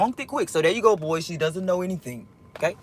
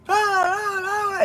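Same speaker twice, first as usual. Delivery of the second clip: high mock noise bursts; 10 semitones higher, much slower than usual. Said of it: "Like, I would hear".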